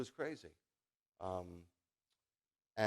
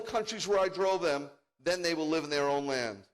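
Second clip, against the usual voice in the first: preaching scripture; 6 semitones higher, 8 dB louder than usual. Acted. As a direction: declaim the prophecy at full force.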